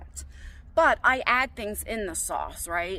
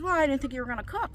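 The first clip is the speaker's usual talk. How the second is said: higher, quick mimicry of a daughter; quick quoted excuse, pitched up; imitating daughter's excuse; 5 semitones higher, faster than usual. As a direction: toss off a rushed, high-pitched mock excuse. Same excuse voiced a second time.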